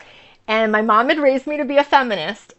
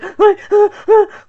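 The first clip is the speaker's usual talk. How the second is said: sharp, high gasp for breath; overwhelmed gasp; 10 semitones higher, 7 dB louder than usual. Retold or imitated. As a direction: gasp like you can't catch your breath. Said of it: "I couldn't catch my breath".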